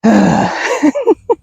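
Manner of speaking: drawn-out sigh before a laugh; exasperated sigh. Real, not acted. Exasperated, amused by the dog.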